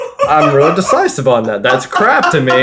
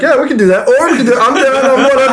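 indecisive whatever; 12 semitones higher, 3 dB louder, faster than usual. Retold or imitated. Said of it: "I'm just like".

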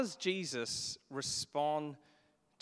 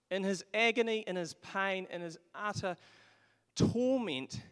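rising, louder preaching on each phrase; preaching at full force; 7 semitones higher, 5 dB louder than usual. Acted. Preacher building to the cross's agony, louder each phrase. Preaching climax on Jesus' pain.

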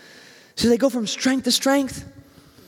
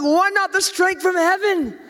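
eager pick; 7 semitones higher than usual. Pretend. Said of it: "I'm like".

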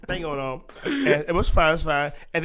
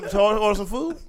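normal talking voice

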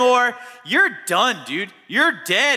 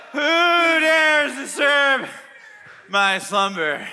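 slow, spooky ghost voice; slower than usual. Acted. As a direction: intone it like a woken spirit. Samuel's ghost, woken from the dead.

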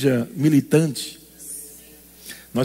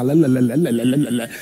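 low sing-song "lé, lé, lé"; mock the whining chatter; low droning "lé lé lé"; mocking blah blah; 7 semitones lower than usual. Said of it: "mocking whining".

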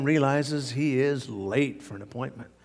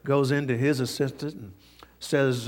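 ordinary talk, nothing marked

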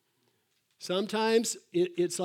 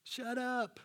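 high, softer mock shushing; mock rebuke; 6 semitones higher, 5 dB quieter, much slower than usual. Crowd rebuking the blind beggar, high hushed mock rebuke. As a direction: imitate a crowd hushing someone, pitched up and kept down. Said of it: "They rebuked him and told him".

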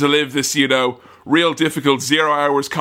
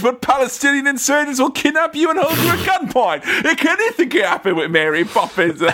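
Cockney, rising higher and laughing; Bert impression; 5 semitones higher than usual. Bert warning the children, rising mock Cockney. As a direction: spin a wild tale as cheeky Cockney Bert. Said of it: "He's like".

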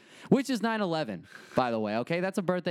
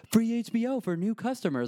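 commercial announcer; 9 semitones higher than usual. Retold or imitated. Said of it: "air a commercial like".

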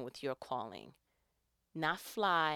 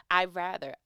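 ordinary talk, nothing marked

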